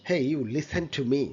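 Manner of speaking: normal talking voice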